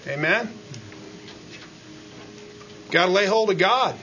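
ordinary delivery

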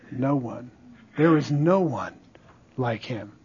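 very low, soft answer; hushed awe. Real, not acted. Preacher answering who is like God, in hushed awe. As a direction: drop right down, almost to nothing.

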